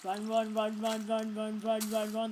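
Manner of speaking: frantic repeated run, run; panicked run. Real, not acted. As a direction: shout it while fleeing in a game. Fleeing monsters.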